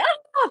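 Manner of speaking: a sudden high startled shriek; mock scream. Picturing meeting the creepy toy at night, a sudden high shriek. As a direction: shriek as if jumping at the thing. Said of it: "I'd be like".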